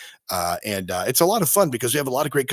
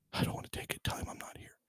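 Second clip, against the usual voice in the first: whisper; 16 dB quieter, faster than usual. Pretend. Dodging Satan's phone call.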